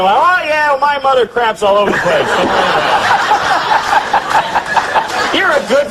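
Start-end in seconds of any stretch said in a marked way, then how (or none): 0.2-2.3 loud, higher, over-agreeable chime-in; feigned listening. Acted. Listener covering up that he tuned out of a friend's story, over-eager agreement. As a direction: bluff along as if you followed every word. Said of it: "don't wanna seem like I'm listening".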